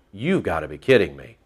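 low, soft incredulous remark; muted disbelief. Retold or imitated. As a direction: say it under the voice, dismayed. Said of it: "I'm going".

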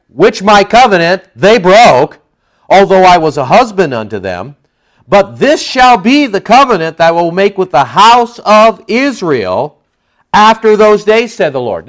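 preacher's scripture reading swelling to a high, loud climax; scripture proclamation building. Acted. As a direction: proclaim the verse, rising to a peak at the end. Reads scripture aloud, building.

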